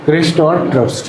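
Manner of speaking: a student's take on "crest और troughs", mildly voiced; lightly mimicking a pupil's pronunciation; imitating a student. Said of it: "क्या अलग बोलते हो आप?".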